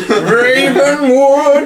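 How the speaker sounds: high, very slow, theatrical call; dramatic cry. Acted. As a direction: stretch the name out like a battle cry.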